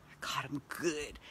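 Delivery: slow soft whisper; secretive whisper; conspiratorial whisper. Real, not acted. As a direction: lean in and whisper it. Secret psychic reveal.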